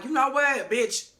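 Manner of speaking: sassy high snap at Ryan; mock confrontation. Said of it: "he is like".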